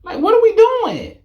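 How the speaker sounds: high, loud disbelieving cry; stunned, half-laughing disbelief; squeaky shocked disbelief; incredulous. Real, not acted. Incredulous reaction.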